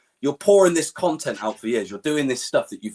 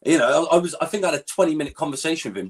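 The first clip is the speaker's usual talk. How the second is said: normal talking voice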